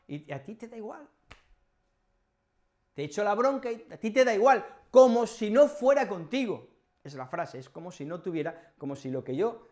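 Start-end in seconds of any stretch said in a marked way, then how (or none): 2.9-6.7 high, loud, fast scolding; angry telling-off. Shown as a demonstration. To someone who shrugs off a scolding, an angry raised telling-off. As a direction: let your irritation boil over. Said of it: "Te estoy gritando, estoy muy enfadado".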